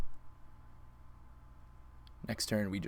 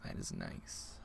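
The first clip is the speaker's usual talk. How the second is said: much lower, softer whisper; 11 dB quieter, slower than usual. Real, not acted. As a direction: breathe "That is nice" low. Savoring the win.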